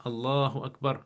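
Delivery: ordinary talk, nothing marked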